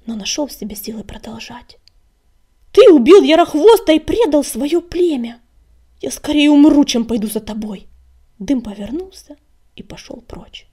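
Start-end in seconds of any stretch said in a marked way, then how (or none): 2.7-7.9 loud, high, furious accusation; impassioned shout, dropping at the end; bitter fury. A narrator's reading. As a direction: cry out in bitterness and rage. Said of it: "Он содрогнулся от горечи и ярости".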